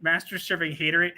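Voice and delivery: ordinary talk, nothing marked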